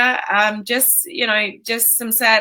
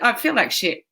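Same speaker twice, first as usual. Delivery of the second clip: laughing; 6 semitones higher than usual. Real, not acted. Laughing at her own words.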